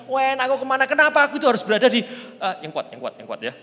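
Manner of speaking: high, loud complaining; hammed-up loud grumble; mock whining youth. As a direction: rant like a restless teenager. Acting youth complaining of rules.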